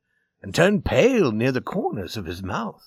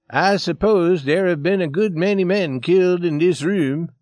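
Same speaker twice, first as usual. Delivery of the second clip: lightly voiced character, higher and louder; the Swede; 5 semitones higher, 5 dB louder than usual. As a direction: raise the voice into an uneasy stranger's remark. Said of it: "the Swede spoke to Johnny".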